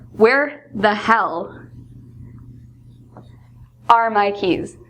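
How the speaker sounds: slow, drawn-out exasperated "Where the hell"; stressed out. Shown as a demonstration. Late for work, teacher acts out losing keys, slow exasperation. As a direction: drag it out, fed up with searching. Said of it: "very stressed out".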